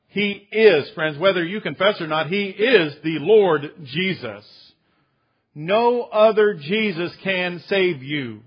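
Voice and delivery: loud, slow, emphatic declaration; preaching at full force. Acted. Pastor proclaiming to the congregation, loud and slow. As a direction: drive it home from the pulpit.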